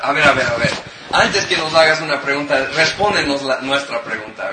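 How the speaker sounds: loud, pushy challenge; indignant demand. Acted. Religious leaders loudly demanding Jesus answer first.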